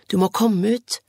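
loud, urgent call. A narrator's reading. Anne calling Beate outside from the oak tree, loud shout. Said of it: "ropte hun".

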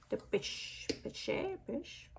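whispered tries at "Peach", testing the sound under her breath; unsure pronunciation. Real, not acted. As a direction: mouth "Peach" quietly over and over, unsure how to say it. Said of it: "I don't know how you pronounce this".

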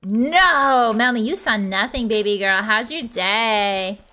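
put-on Valley Girl with stretched words. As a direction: sweet-talk like a Valley Girl. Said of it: "five minutes of Valley Girl".

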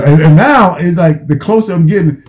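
normal talking voice

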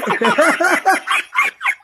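very high squeaky giggle; laughing through a chipmunk voice changer. Real, not acted.

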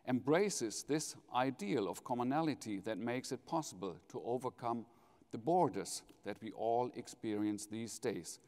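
ordinary talk, nothing marked

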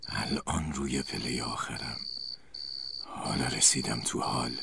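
low, softened creepy voice; whispery voice. A narrator's reading. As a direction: creep closer in a hushed, low voice. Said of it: "همون صدای پچ پچ وار گفت".

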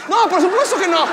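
high, loud burst of denial; emphatic denial. Acted. Comedian retracting his boast of fighting skill, emphatic denial. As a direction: burst out against your own boast.

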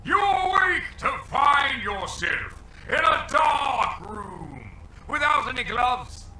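booming, slow announcer shout; dramatic shout. Acted. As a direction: belt it out like a trailer voice. Dramatic wake-up in game narration.

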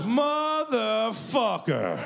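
high, slow, drawn-out imitated holler; drawn-out yell. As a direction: stretch the word out in a high yell. Host yells once more.

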